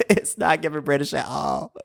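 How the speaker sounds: amused, laugh-laced repeat. Real, not acted. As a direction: say it through giggles. Repeats joke while laughing.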